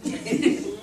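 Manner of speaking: big demonstrative intake of breath; mimed gasp. Retold or imitated. Student obeys the order to hold his breath, mimed gasp. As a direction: suck in air to hold it. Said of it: "I went".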